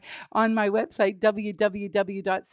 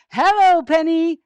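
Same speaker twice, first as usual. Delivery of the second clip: higher, louder welcoming call; excited greeting; 6 semitones higher, 10 dB louder than usual. Pretend. Host calls Penny on to tell jokes, excited greeting. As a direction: call out brightly to a guest. Calling the puppet guest on.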